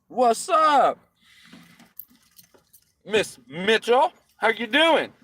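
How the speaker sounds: loud high hello to a viewer; excited greeting. Real, not acted. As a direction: call out a big hello.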